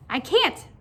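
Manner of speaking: plain reading voice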